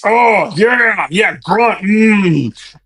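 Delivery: a hint of a grunting tough guy; lightly voiced manly grunts, holding back tears; macho man. Holding back tears at work, mimicking a tough man with grunts. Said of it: "Man, man thinks".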